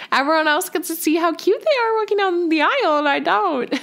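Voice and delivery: high, whiny mock complaint; mock whine. Real, not acted. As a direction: play up the self-pity.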